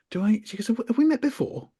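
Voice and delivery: high, wondering medium voice; a hint of a dreamy medium; mock medium. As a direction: lightly send up the psychic. Medium voiced much higher.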